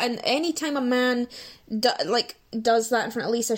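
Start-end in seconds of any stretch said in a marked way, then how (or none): none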